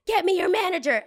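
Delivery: high, loud customer yell; mock outraged demand. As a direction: shout it up high as an indignant guest. Mocks guest demanding the manager.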